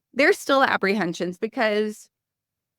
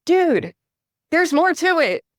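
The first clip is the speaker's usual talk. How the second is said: higher and louder, pushing back; exasperated dude; 10 semitones higher, 5 dB louder than usual. Retold or imitated. Telling brother-in-law buy-and-hold is not enough, exasperated. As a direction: set your brother-in-law straight. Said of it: "And I'm like".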